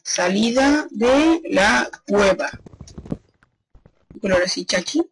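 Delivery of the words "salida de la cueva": drawn-out words while labelling something; slow word by word. Real, not acted. Player labelling the cave exit, slow word by word. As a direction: say it very slowly, stretching each word.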